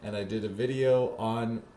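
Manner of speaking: ordinary talk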